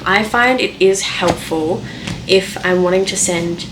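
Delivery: ordinary delivery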